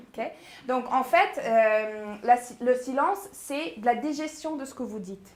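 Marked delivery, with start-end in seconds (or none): none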